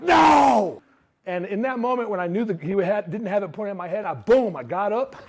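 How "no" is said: loud, high single shout of no; defiant shout. Retold or imitated. Shot salesman shouting no at the gunman. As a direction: refuse him with everything.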